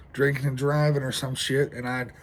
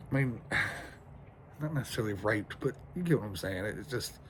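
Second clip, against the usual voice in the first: defeated sighing; 4 semitones lower, 9 dB quieter than usual. Real, not acted. Despairing after losing his car.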